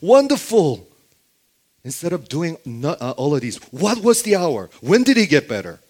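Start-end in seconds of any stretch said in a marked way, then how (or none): 0.0-0.9 loud, high, drawn-out delight; hammed-up elation, voice soaring; mock overjoyed. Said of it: "That would be the first response". 3.7-5.8 louder, higher acted questions; insistent acted questioning; urgent questioning. Acts father urgently questioning servants.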